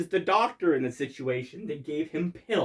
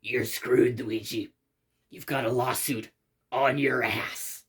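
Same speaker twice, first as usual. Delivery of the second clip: low, deadly serious imitation; Doctor Bones, very serious; 4 semitones lower, slower than usual. Smart-ass doctor telling Luigi of the lawsuit, mock very serious. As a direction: deliver the bad news gravely, pitched down. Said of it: "he's in a very serious tone".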